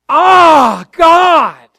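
high, loud cry of inner pain. Shown as a demonstration. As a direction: cry out to God as if it hurts. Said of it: "spoke of a painful feeling on the inside".

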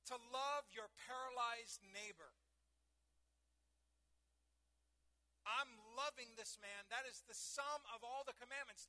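loud, driving sermon voice; preaching at full force. Acted. Preacher voicing Jesus to the Pharisees, loud and driving. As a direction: hammer it home like a pulpit preacher.

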